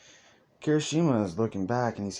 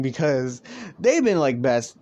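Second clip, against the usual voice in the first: normal talking voice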